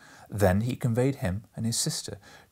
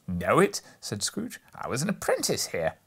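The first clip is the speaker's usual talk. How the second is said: surprised; 4 semitones higher than usual. Acted. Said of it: "Scrooge surprised".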